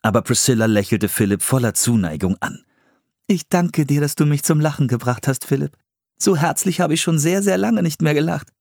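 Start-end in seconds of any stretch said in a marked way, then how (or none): 3.2-8.5 higher-pitched, affectionate character voice; storybook woman voiced with affection; grateful young woman. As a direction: thank him sincerely in a higher voice.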